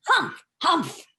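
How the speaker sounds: two sulky huffs; indignant huffs. Acted. Ducks storming away after the quarrel, huffing. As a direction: huff off in a snit.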